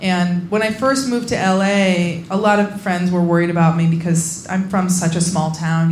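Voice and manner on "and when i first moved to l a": ordinary delivery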